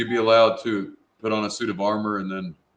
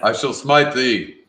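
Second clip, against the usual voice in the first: put-on slow, solemn old-English decree; mock biblical prophet; 5 semitones higher, 7 dB louder, much slower than usual. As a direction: pronounce it like a pompous knight. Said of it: "They would take that shit so serious".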